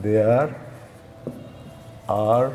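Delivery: very slow, word by word with long pauses; writing-out pace. Real, not acted. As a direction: say each word as you write it.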